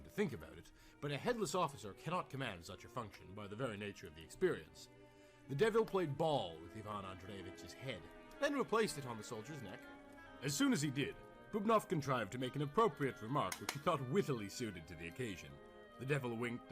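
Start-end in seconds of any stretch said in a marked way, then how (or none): none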